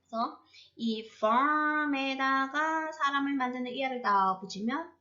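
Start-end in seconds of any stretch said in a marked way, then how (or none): 1.2-2.9 farm에다가 held very long on a level tone; drawn out. Shown as a demonstration. Teacher adding a suffix to make a person, word held long. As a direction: stretch farm에다가 out slowly.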